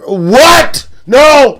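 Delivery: sudden loud, high yelp of disbelief; shocked outburst. Real, not acted. Streamer reacting to chat's news, shocked loud cry. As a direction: blurt it out as the news hits.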